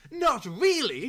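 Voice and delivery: high, slow, astonished; amazed gasp. Acted. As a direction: gasp in awe. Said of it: "gasped Mister Saltena".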